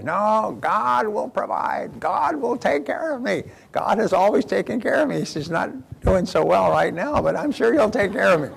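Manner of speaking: cling to faith, refusing the rescue in a high put-on voice; stubborn farmer.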